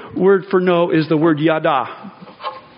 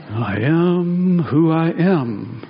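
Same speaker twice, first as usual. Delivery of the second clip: slow, dreamy hippie guru drawl; sixties hippie voice; much slower than usual. Retold or imitated. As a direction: stretch it out like a spaced-out sixties guru. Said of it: "in sort of a sixties hippie voice".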